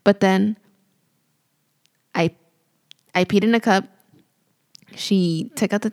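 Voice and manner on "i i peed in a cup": ordinary talk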